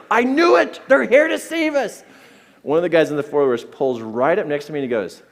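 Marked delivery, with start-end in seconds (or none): 0.1-2.0 put-on high, loud boyish exclamation; triumphant child's shout. Son seeing rescuers arrive, triumphant high yell. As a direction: crow it like an overjoyed kid.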